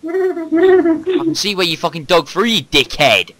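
loud, high, angry ranting; angry yelling. Real, not acted. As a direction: yell at him furiously. Angry at friend digging house.

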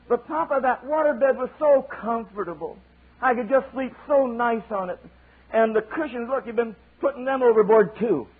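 high, whining little eaglet voice; squeaky baby bird complaining; whiny child eaglet. Acted. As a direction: plead like a small child. Child eaglet pleading with papa.

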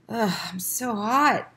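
put-on a sick boy mumbling slowly, half awake; feverish mumble. A narrator's reading. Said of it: "mumbled Keith".

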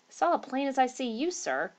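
ordinary delivery, nothing marked

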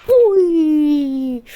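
loud, slowed cheer after a great goal. Real, not acted. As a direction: belt out the praise for your own shot. (yüksek sesle, yavaş ve coşkulu sevinç)

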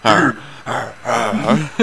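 playful copied ape grunts, lightly voiced; gorilla grunts. Player copies friend's gorilla noises, light mock grunting. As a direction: echo the grunt back, amused. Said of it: "Those noises, man, he makes".

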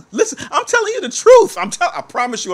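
high laugh into the words; giggling insistence. Real, not acted. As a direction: laugh through the protest. Laughing at own story.

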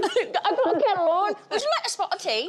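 a mock British host offering tea; awful British accent. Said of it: "this awful British accent of yours".

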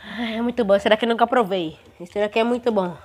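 chewing while talking; savoring a sweet bite; mouth partly full. Real, not acted. Seen 0: holds opened bar, hand to mouth, jaw moving after tasting.

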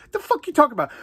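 ordinary talk

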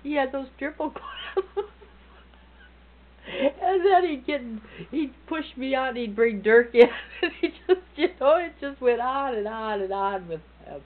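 squeaky tearful laughter through words; laughing hard. Real, not acted. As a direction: laugh till it nearly cries. Laughing hard retelling prank.

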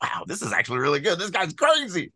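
quick retold amazement, rising high at the end; amazed reaction. Author recalling reading his rewritten story, amazed. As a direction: mimic your own delighted surprise, climbing in pitch.

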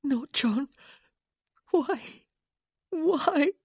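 high, pleading whimper. Acted. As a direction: plead it high like a frightened widow. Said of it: "Francesca was whimpering those very same words".

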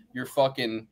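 normal talking voice

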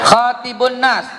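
slow, high chant for the audience to echo; slow call for repetition. Shown as a demonstration. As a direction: lead the repeat slowly. (ucapkan pelan untuk ditirukan)

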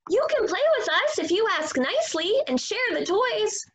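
high, quick little pig voice. Acted.

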